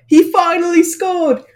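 British accent mimicking the Prime Minister cheering a goal; Boris Johnson British accent. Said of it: "sorry for my British accent there".

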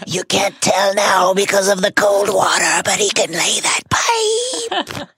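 high, drawn-out villain wail; mock-sinister crowing, climbing high; hammed-up Horcrux voice. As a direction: push the evil-spirit taunt into silly parody, stretching the last word. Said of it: "But the Horcrux continues".

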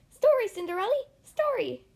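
high, loud squeaky mouse cry; excited mice cry. Acted. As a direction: beg loudly in high mouse voices. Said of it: "the mice cried".

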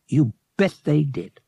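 ordinary delivery

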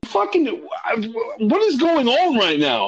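very high, exasperated sputter; flustered. Retold or imitated. Patient at a loss with urgent care guy, flustered. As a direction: stumble over the words in high-pitched exasperation. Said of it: "getting a little more flustered".

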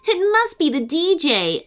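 working it out, a touch puzzled. A narrator's reading.

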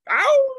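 very high, loud mock cheering whoop; a sudden falsetto ow like a cheering crowd.

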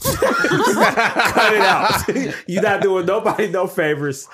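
half-laughing telling off; laughing scold. Real, not acted. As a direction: tease him while cracking up. Laughing scolding of actor's accent.

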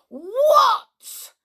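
a very high, loud, exploding cry of rage; sudden fury. Acted. Uncle exploding at the children for entering his tower, furious cry. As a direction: erupt at them out of nowhere. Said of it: "exploded his uncle in a sudden surprising fury".

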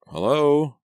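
plain reading voice

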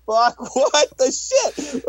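high, drawn-out mock disbelief; hammed-up stunned reaction; retold shock. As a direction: stretch each word in baffled astonishment. Retelling shocked reaction to the movie.

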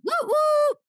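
loud, high-pitched double whoop; high whooping cheer. Real, not acted. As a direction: whoop along with the co-host. Cheer in show opening.

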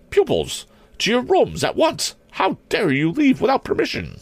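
very high, outraged matron voice; scolding headmistress. A narrator's reading. Principal orders her pupils back to their rooms, outraged. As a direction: order them off like a strict schoolmistress, pitched high.

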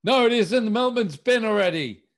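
high, loud mock indignation; hammed-up defiant tenant. Improvising debtor answering the enforcer, loud and high. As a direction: overplay a cocky debtor at the door. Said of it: "And I go".

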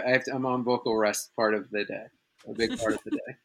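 talking carefully on a sore tongue; soft, careful words through a sore tongue. Real, not acted. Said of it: "my tongue is sore".

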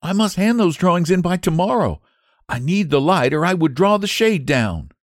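put-on high, bright young woman's voice; young Sue. Sue's voice in the story.

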